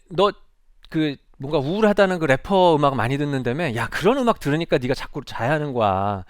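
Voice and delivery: loud teacher's lecture, pitch climbing to the end; meddling, nagging. Acted. Teacher blaming a student's music choices, nagging. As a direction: act out an adult butting in with reproaches. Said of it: "개입과 간섭이라고 느껴지는 말들".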